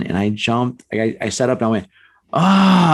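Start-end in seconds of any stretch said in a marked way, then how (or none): 2.3-2.9 a sudden full-throated scream of fright, high and loud; jolting up in bed and yelling "Ah!" as loud as possible; retold loud yell. Teen startles the crawling intruder with a loud scream. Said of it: "as loud as I can yell".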